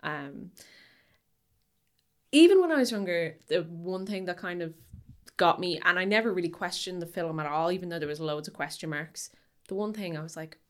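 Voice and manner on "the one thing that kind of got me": ordinary talk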